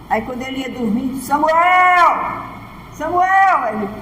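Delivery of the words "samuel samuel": louder divine call. Retold or imitated. God calling louder.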